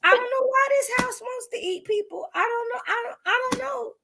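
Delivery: high-pitched, whimpering bewilderment; hammed-up confused whine; mock panic. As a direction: play it like a lost, frightened reader. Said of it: "I was like".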